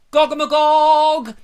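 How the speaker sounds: high, loud, slow chanted cry; ritual shout. Pretend. Friends standing up in the pub, loud ritual cry. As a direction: leap up and cry it out. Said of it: "stand up and go".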